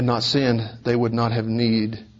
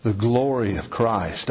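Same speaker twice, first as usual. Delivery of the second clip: usual speaking voice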